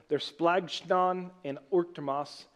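splagchnon and orktomos said slowly and carefully; careful slow Greek pronunciation; Greek words spelled out. Shown as a demonstration. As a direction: sound out splagchnon and orktomos syllable by syllable. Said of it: "sounds kinda like a fancy European dish".

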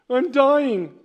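loud, high mock-dying whine; melodramatic. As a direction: overact the tragedy for a laugh. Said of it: "my mom used to call me melodramatic".

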